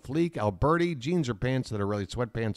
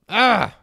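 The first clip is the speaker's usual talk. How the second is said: short loud higher "ah"; sudden yell; 9 semitones higher, 11 dB louder than usual. Real, not acted. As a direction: let out an abrupt cry. Sudden outburst between remarks.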